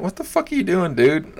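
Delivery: high-pitched, mock-exasperated challenge; indignant retort. Comic retelling a challenge to a heckler, indignant. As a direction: retell it with incredulous, rising pitch.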